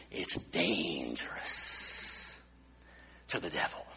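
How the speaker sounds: it's dangerous said low, soft and slow like a dark secret; dramatic warning. Acted. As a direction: drop the voice way down on the warning.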